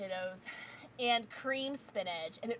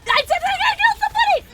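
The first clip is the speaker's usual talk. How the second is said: very high, loud excited shriek; triumphant; 15 dB louder, faster than usual. Real, not acted. Gamer celebrating a kill, triumphant shriek. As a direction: scream it out in triumph.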